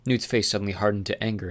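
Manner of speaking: plain reading voice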